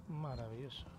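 ordinary talk, nothing marked

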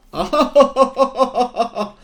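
high, loud villain laugh; evil laugh. Real, not acted. Gamer finally made the killer, gleeful villain laugh. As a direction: cackle in triumph.

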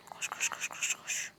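whispered koş koş koş; breathless whispered urging to run; urgent whisper. Real, not acted. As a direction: hiss at them to run, under your breath. Urging character to run stealthily.

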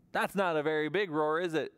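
usual speaking voice

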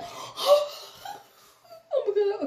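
high, slow gasping cry of shock; a stunned, breaking oh my God. Retold or imitated. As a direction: gasp it out half laughing, half crying. Hearing cousin rushed to hospital.

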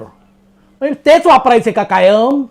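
loud, high, drawn-out mock scolding; acted irritated outburst; imitated snapping. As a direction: snap back indignantly as the offended man. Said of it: "एक जण खेकसला माझ्यावर".

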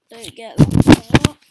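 sudden blast at full volume; shouting out. Real, not acted. Gamer yelling at the screen, sudden loud shout. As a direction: yell it out as the loot slips away.

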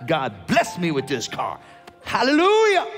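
hammed-up protest. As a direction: exult as if wrongly accused. Mock defensive excited protest.

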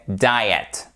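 Diet en « DA-ï-et »; bonne prononciation. Shown as a demonstration. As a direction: pose Diet avec une diphtongue « aï ». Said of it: "C'est pas le diète". (Diet à l'anglaise, « daïet », descendant)

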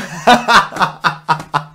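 loud laughing fit; loud laughter. Real, not acted. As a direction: burst out laughing. Laughs at video. (riso alto)

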